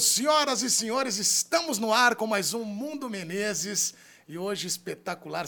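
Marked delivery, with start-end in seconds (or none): 0.0-3.9 booming circus announcer, slow; ringmaster. Acted. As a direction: call it out like a ringmaster. Circus-style show opening.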